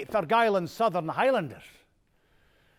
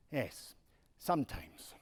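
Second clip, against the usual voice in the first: breathy afterthought, barely voiced; sly whispered aside; 6 semitones lower, 11 dB quieter than usual. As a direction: tack it on in a whisper, like a confession. Humble man joke, whispered aside.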